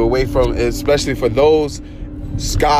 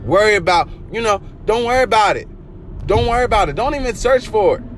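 high, insistent preaching; booming motivational speech; 9 semitones higher than usual. Acted. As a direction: lift it high and hammer don't worry.

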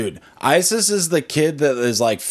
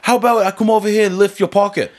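high, quick mock British thug; British accent; 7 semitones higher, faster than usual. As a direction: threaten in a put-on British accent. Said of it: "like a British accent".

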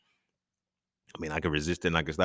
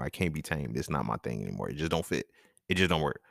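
ordinary talk, nothing marked

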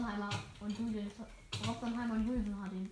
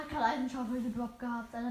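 ordinary talk

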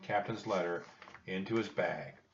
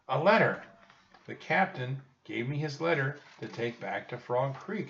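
put-on raised boy's voice, explaining firmly; insistent Jack; 6 semitones higher, 4 dB louder than usual. A narrator's reading. Jack telling Annie about the captain's letter, insistent. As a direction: start loud and high, then explain.

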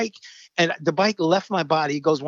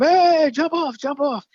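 put-on kids calling out; 11 semitones higher, 4 dB louder, slower than usual. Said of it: "kids would go up the ramp".

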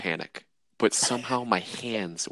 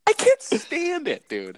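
slow, very high exasperated outburst; 3 dB louder, slower than usual. Real, not acted. Podcaster venting to his co-host about a habit, exasperated. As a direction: stretch it out in high-pitched exasperation.

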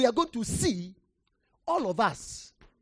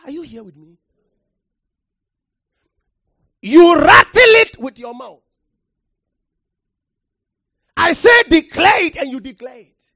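loud, rising pulpit delivery, hammering the word; preacher thundering from the pulpit; full-force preaching; 5 semitones higher, 15 dB louder than usual. Acted. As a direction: preach it hard to the congregation.

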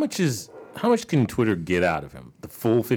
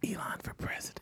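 whispering; 14 dB quieter than usual. Acted. Joke whispered aside.

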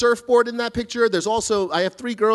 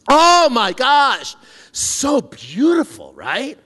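mock awe; 5 semitones higher, 7 dB louder, much slower than usual. Mock admiration of overgrown backyard photo.

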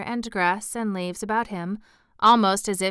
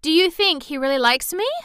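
high, louder hopeful question; eagerly wanting to know; 6 semitones higher, 6 dB louder than usual. A narrator's reading. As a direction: ask eagerly, lifting at the end. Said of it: "She did so want to know".

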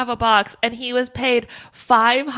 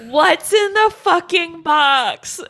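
louder, higher and slow anguished cry; desperate shout; 8 semitones higher, 5 dB louder, slower than usual. Retold or imitated. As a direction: scream it out in disbelief. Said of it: "he says".

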